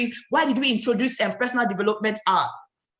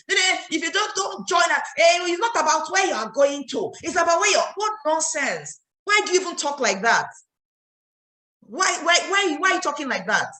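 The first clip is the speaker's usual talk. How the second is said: higher-pitched angry rant; 6 semitones higher than usual. Real, not acted. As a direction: scold in exasperation, pitched up. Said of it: "'cause I'm angry".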